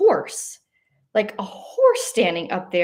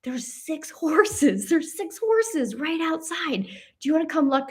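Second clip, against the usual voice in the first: excited news; 8 semitones higher than usual. Retold or imitated. Telling sleeping husband about six horses outside, excited and higher. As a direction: draw it out, thrilled but keeping it down.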